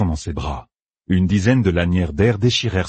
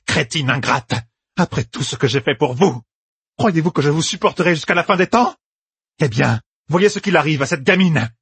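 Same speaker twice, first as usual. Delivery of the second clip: higher-pitched, ranting accusation; enraged; 8 semitones higher than usual. A narrator's reading. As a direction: rant at the whole assembly, feeling betrayed. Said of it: "Folle de rage". (tirade pleine de rage)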